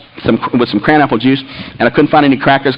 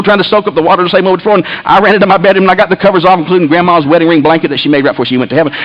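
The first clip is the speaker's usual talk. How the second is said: ordinary talk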